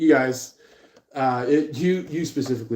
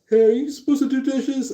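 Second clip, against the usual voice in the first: much higher, quick put-on questioning voice; family member checking; 14 semitones higher, 5 dB louder, faster than usual. Family member checking on the dishes, high and quick. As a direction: chirp the question high and fast. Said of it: "show up and they're like".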